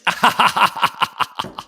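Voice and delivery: loud, rapid cackling laugh. Real, not acted. Cracking up at own crude coin idea, loud laugh. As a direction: burst out cackling at your own joke.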